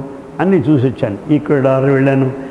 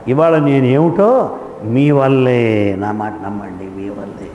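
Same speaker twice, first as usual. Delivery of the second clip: sincere thanks; 6 semitones lower, slower than usual. Real, not acted. Thanking the audience earnestly.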